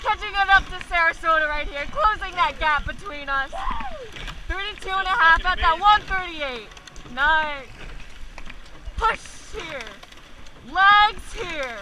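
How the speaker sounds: loud, high urgent calls over the boat; shouting coxswain calls. Real, not acted. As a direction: drive the crew with every count. Coxswain calling power ten.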